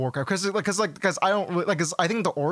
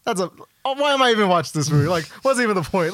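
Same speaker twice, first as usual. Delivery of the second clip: raised, louder mock exasperation; exasperated rant; 5 semitones higher, 8 dB louder than usual. Friend retelling watching the film, exasperated and louder. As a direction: rant at the movie like it wasted your time. Retold frustration at movie.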